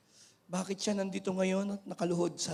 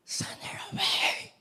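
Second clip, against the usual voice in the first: higher, unvoiced, slow whisper; mock whisper; 12 semitones higher, slower than usual. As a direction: whisper it, annoyed, to the master. Disciples voiced dismissing the woman. (pabulong na pagtaboy)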